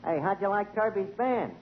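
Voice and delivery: ordinary talk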